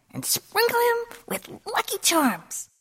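very high, lilting goddess voice; sing-song it in a high, dainty voice; second goddess. A narrator's reading. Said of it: "said Da".

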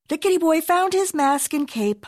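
ordinary delivery, nothing marked

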